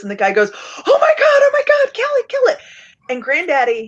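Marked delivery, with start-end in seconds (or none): 0.5-2.6 high frantic shrieks, close to crying; panicked screaming. Retold or imitated. Hunter begs granddaddy to kill the charging buffalo, panicked screaming. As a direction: scream it like the buffalo is about to trample you.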